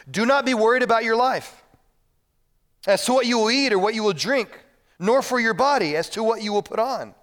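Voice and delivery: loud, high, commanding verse; forceful preacher quoting Jesus; strong scripture reading. Acted. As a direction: proclaim it to the whole church. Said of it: "he spoke like this with even stronger words".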